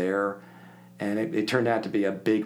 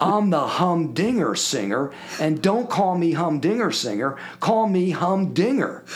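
slow, louder, higher showman's voice; hammed-up boast; 8 semitones higher, 5 dB louder, slower than usual. Record man proclaiming his nickname to the teacher, slow showman boast. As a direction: announce the nickname grandly, drawn out. Singer names himself Humdinger.

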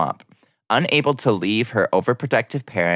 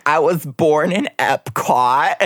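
put-on trashy Florida girl; 7 semitones higher, slower than usual. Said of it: "One of the girls was like".

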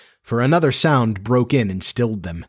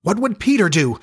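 put-on high, urgent boys' question; eager cry; 6 semitones higher than usual. A narrator's reading. Boys in danger think of their leader, high urgent cry. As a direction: cry it out, pitched up. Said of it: "they cried simultaneously".

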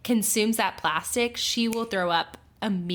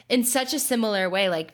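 normal talking voice

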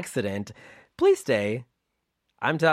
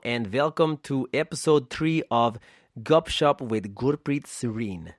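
hammed-up, clumsy Russian accent; bad Russian accent. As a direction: open the show in a badly faked Russian accent. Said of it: "by far the worst Russian accent ever".

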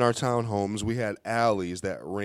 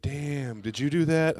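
higher-pitched mock amazement; impressed disbelief; 7 semitones higher than usual. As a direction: retell a stunned cousin's question. Cousins react to the toilet prank.

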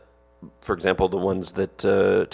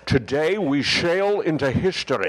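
higher, grand Scottish Connery delivery; Connery movie line; 5 semitones higher than usual. Pretend. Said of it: "that was Sean Connery".

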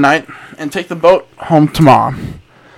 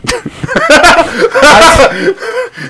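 helpless laughing fit; 12 semitones higher than usual. Real, not acted. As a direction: crack up mid-reading. Breaks up at creeping women.

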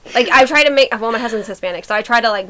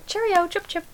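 high, soft mock English accent; badly faked English accent, pitched up; attempted English accent; 8 semitones higher, 9 dB quieter than usual. Shown as a demonstration. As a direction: try a chirpy caricature English accent. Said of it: "What is, what is an English".

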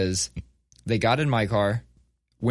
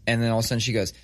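ordinary talk

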